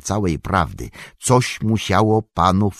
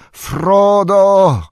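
much higher, louder long call; shout; 11 semitones higher, 8 dB louder than usual. A narrator's reading. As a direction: yell his name up the hillside. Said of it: "wciąż krzycząc". (przeciągły krzyk, dużo wyżej i głośniej)

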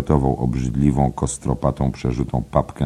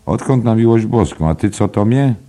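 high, loud disbelief; mock outrage at the order; incredulous; 10 semitones higher, 7 dB louder than usual.

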